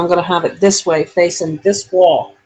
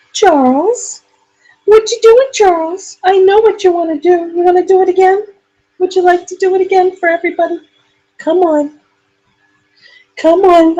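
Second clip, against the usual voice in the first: very high, sing-song pet voice; baby talk to a dog; 11 semitones higher than usual. Acted. Owner coaxing her dog Charlie to pray, high baby talk. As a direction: coo at the dog like a toddler.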